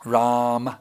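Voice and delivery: "Ram" with a subtle final vowel; demonstrating Ram. Shown as a demonstration. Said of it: "Did you hear the, uh?".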